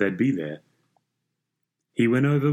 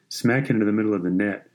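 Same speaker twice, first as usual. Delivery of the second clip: usual speaking voice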